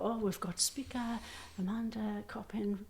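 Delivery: hushed whisper. Retold or imitated. Hosts announcing her to the room.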